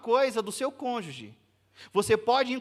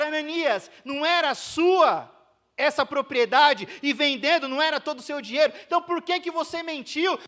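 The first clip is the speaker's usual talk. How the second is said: higher and louder accusation; angry confrontation; 5 semitones higher, 8 dB louder than usual. Acted. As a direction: hammer him with the question. Peter confronts Ananias.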